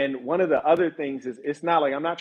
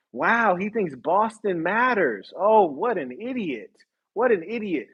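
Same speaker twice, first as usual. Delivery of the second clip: slow, higher-pitched sarcastic wonder; mock amazement; 5 semitones higher, slower than usual. Bosses scoffing at someone from Boston, mock amazement. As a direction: marvel at the fool, drawing it out. Voices bosses marvelling at the fool.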